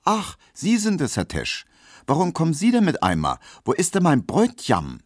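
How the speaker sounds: high, excited maid's call; startled young maid crying out high; Pauline calling out. Acted. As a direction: call out high and eager, expecting her fiancé. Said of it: "und rief".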